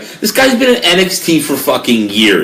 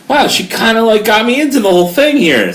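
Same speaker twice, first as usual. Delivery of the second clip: impressed surprise; 9 semitones higher than usual. Retold or imitated. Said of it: "and I'm like".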